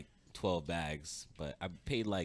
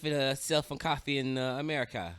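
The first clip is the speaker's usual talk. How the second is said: loud, high Jamaican uncle voice; Jamaican accent; 8 semitones higher, 8 dB louder than usual. Retold or imitated. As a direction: Jamaican patois, lively and loud. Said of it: "with a Jamaican accent".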